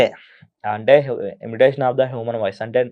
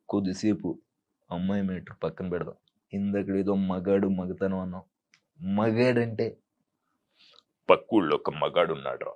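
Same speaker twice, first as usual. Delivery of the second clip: low, soft menacing villain; Sampath villain voice; 7 semitones lower, 8 dB quieter than usual. Retold or imitated. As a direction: brood quietly before the explosion. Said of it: "Sampath గారు అయితే ఎట్లా మాట్లాడతారంటే".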